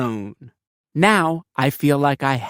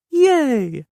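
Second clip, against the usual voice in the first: a much higher, bright cheer; cheering yay; 11 semitones higher than usual. Acted. Kid cheering that a dog needs no instructions. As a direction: celebrate like a little kid.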